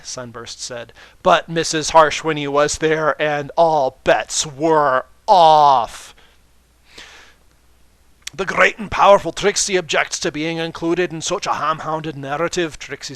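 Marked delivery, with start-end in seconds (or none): 1.2-6.1 dramatic loud slow narration. A narrator's reading. 8.3-12.8 loud, high, theatrical third-person boast; booming grandstanding showmare voice; boastful showman Trixie. Acted.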